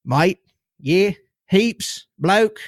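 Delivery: attempted Australian accent. Shown as a demonstration. Host playfully trying Aussie words for listeners, attempted Australian accent. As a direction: toss out Aussie slang in an amateur Australian accent. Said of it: "That's about as good as you're gonna get from me".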